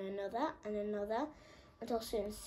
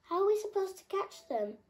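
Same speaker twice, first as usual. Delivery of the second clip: high, louder asking; puzzled horse; 10 semitones higher, 6 dB louder than usual. A narrator's reading. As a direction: ask in dismay. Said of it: "asked the stripy horse".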